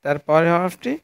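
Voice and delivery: ordinary talk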